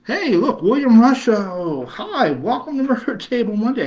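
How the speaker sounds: excited greeting. Real, not acted. Greeting a viewer in chat.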